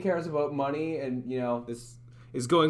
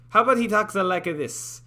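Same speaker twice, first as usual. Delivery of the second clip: raised, louder Italian-accented character voice; 4 semitones higher, 5 dB louder than usual. Shown as a demonstration. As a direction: try him out as an Italian. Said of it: "I'm not going Italian".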